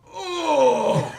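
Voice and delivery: loud, high cry after a whack; high startled howl; pained yelp. Acted. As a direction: yelp as if just clobbered awake. Sleeper yelps after being thunked.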